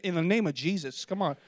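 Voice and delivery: normal talking voice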